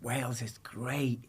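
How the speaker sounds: breathy whispered aside, slow. Acted. To the host, a slow joking whisper. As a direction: lean in and whisper it like a secret.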